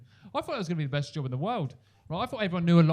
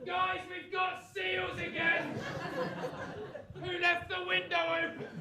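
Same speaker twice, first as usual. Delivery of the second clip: high, slow, fed-up shout about seals; exasperated family shout; 6 dB quieter, slower than usual. Pretend. Flatmate shouting to the house about seals, high and exasperated.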